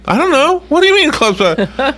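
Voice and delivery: high, baffled reply; puzzled. Retold or imitated. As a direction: act confused. Retold confused reply.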